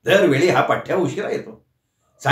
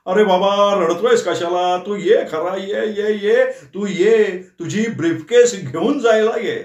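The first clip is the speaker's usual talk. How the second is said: gloating tease; 6 semitones higher than usual. A narrator's reading. Nana teasing Shitole on phone.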